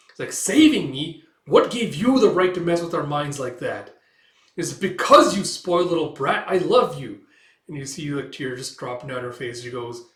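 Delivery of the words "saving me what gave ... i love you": higher, much louder mock yell; furious outburst. Gail confronting the witch, shouting. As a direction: rage at her for meddling.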